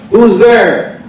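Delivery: high called question from upstairs; calling out. Retold or imitated. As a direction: call it out from a window. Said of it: "calls out".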